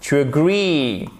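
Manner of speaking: slow "agree", long stressed -GREE; stress on second syllable. Shown as a demonstration. Teacher giving the correct verb, stressed second syllable. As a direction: draw out the ee in agree. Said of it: "c'est sur la seconde".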